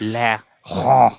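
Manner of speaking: "Laurent" with a drawn-out throaty r and silent t; French pronunciation. Shown as a demonstration. Coaching Dad to copy it, "Laurent" in French pronunciation. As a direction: exaggerate the French "Laurent", nasal ending rising. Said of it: "Try it".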